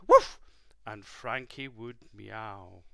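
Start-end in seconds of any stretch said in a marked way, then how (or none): 0.1-0.4 sharp high loud woof; dog bark. Acted. Storyteller barks for Bobby the dog, loud and high. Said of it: "Bobby would bark".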